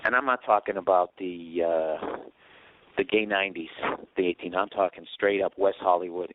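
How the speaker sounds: ordinary delivery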